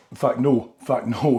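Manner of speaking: ordinary talk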